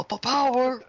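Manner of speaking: high, loud catchphrase bellow; hammed-up ad shout. Mimicking an over-the-top commercial, hammed-up shout. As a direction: build to a booming last word.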